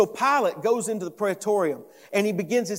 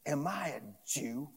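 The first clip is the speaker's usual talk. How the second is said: low, much softer scornful question; Pilate's disdain; 4 semitones lower, 12 dB quieter than usual. Acted. Pilate answering Jesus on the defensive, disdainful. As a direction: toss it back with quiet distaste.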